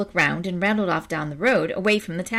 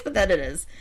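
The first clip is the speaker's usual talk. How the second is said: usual speaking voice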